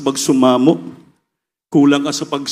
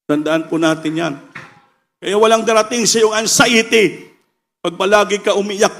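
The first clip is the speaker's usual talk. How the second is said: usual speaking voice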